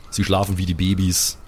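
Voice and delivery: normal talking voice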